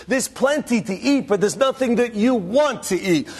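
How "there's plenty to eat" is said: ordinary talk, nothing marked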